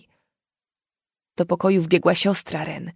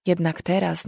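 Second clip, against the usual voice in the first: plain reading voice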